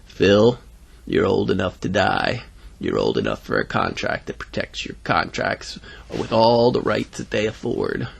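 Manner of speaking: low, softened salesman voice; menacing undertaker. Acted. Undertaker tells a boy he is old enough, low deliberate voice. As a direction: press the point low and deliberately.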